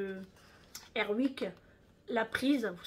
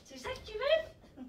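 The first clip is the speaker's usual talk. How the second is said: much higher pet-talk question; talking to the dog; 12 semitones higher than usual. Real, not acted.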